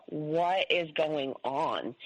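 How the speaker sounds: very slow, word-by-word question; stunned disbelief. Retold or imitated. Daughters watching him slam cupboards, slow stunned disbelief. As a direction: draw out each word as if baffled.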